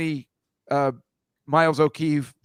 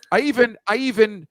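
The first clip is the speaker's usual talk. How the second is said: ordinary talk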